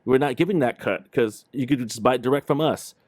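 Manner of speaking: ordinary delivery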